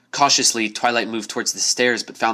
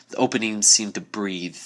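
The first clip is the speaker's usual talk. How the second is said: plain reading voice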